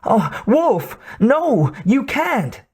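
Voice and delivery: high, crying plea; frightened young man pleading. A narrator's reading. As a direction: beg her with a breaking voice. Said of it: "Otacon pleaded with her".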